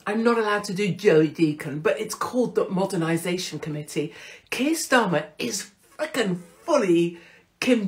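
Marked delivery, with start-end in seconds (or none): none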